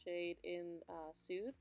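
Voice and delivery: soft, even tutorial voiceover; voiceover mode. Acted. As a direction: narrate the steps calmly to camera. Voiceover narration of makeup.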